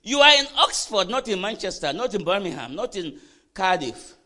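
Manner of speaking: loud, high-pitched preacher's delivery; forceful preaching. Real, not acted. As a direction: preach it out hard to the congregation.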